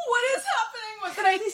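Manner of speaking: high, loud, laughing panic; bewildered cry at the noise outside; mock panic. Woken by music from the tunnel, mock panic with laughter. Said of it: "I was like".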